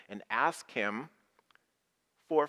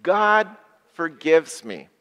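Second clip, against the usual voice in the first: slow loud recital; 5 semitones higher, 9 dB louder, slower than usual. Acted. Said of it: "Say that with me".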